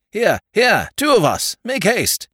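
high, loud urgent shouts; urgent shouting. Acted. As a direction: shout for them to hurry. Calling to rescuers.

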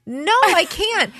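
high-pitched, drawn-out plea; mock panic. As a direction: plead in exaggerated panic, stretched out. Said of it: "pure panic in my eyes".

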